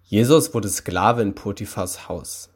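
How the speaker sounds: plain reading voice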